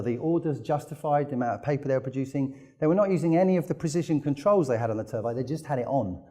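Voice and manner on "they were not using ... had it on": ordinary delivery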